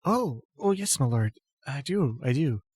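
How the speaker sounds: put-on a devoted girl trailing off shyly; flustered devotee. Ilona answers her lady, stammering and fading away.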